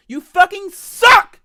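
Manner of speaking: slow, high, screamed at the devs; furious scream. Real, not acted. Streamer screaming at the game's dev team. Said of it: "I'm seething".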